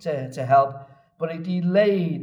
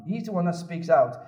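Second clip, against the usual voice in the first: ordinary talk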